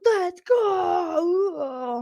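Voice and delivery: high, strained hype cry; a mock cheer near breaking; hyped competitor. Host mimicking Morgan's overdone cheer. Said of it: "She's like".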